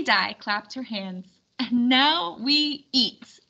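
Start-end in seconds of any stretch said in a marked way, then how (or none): 1.6-3.4 very slow, savoring each word; slow cheerful announcement. Acted. Lady Di announcing dinner, very slow cheerful call. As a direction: ring the dinner bell.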